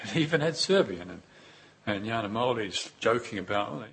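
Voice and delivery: ordinary talk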